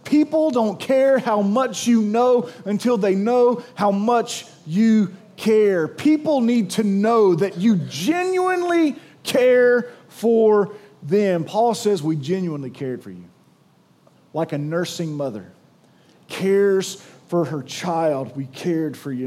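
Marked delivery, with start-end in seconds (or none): 0.0-5.9 slow deliberate saying. Acted. Quoting an old saying. 12.0-19.2 tender, soft reading. Acted.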